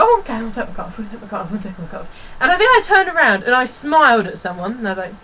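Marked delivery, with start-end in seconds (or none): 0.0-2.3 meek compliance. Retold or imitated. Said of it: "So I was like".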